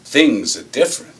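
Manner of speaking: loud, punched folksy lecture, lightly acted; gentleman farmer act. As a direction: set the kid straight with a hint of gentleman farmer. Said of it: "I'm doing my gentleman farmer number".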